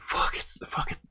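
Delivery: whispered frustrated swearing under the breath; hissed curse. Retold or imitated. Cursing in frustration.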